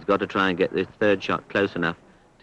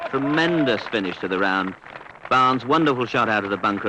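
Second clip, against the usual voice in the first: ordinary talk, nothing marked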